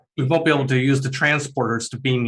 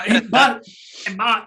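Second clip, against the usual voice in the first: put-on high-pitched young crewman calling; youthful high voice; Scooter; 8 semitones higher than usual. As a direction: pitch it up to get the boss's attention. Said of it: "he's got more of a high-pitched voice".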